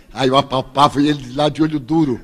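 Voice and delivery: ordinary talk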